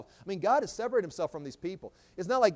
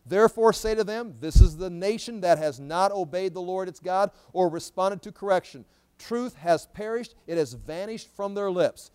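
loud, raised-pitch Bible proclamation; forceful scripture reading; 7 dB louder than usual. Acted. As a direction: proclaim the verse loudly to the congregation. Reads scripture aloud to congregation.

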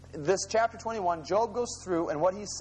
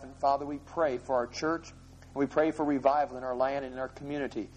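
closing prayer; 4 semitones lower than usual. Real, not acted. Closing prayer after bowing heads.

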